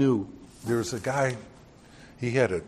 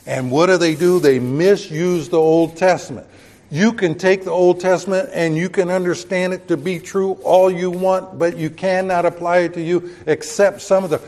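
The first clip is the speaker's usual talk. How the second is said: preaching at full force; 5 semitones higher, 6 dB louder than usual. Acted. Preacher rises again to force.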